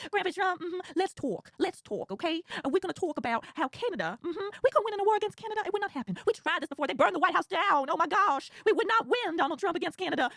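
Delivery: lightly voiced TV host talking down to Grandpa; Joy Reid impression. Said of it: "And she's like".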